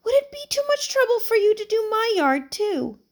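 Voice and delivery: put-on high frail old woman's voice; feeble high-pitched voice. A narrator's reading. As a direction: ask sweetly like a tiny old lady. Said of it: "a feeble, high-pitched voice".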